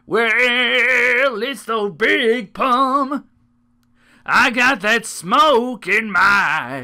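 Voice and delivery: loud, very slow put-on character voice; silly Big Pum voice. Playing the Big Pum for the listeners, slow loud silly voice. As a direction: stretch every word out as the goofy Big Pum. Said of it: "it's this voice".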